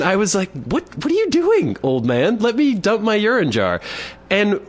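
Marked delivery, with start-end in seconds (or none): 0.5-3.8 high-pitched mock indignation; retold exasperation, pitched very high; indignant protest. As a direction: act out a protest, pitch leaping up. Retold struggle over the jar.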